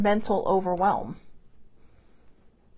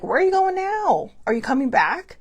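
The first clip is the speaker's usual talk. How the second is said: high, louder pleading pet voice; a cat asking where you're going; pet's plea; 7 semitones higher, 4 dB louder than usual. Pretend. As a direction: voice the pet wondering if you'll come back. Pet's look voiced as a question.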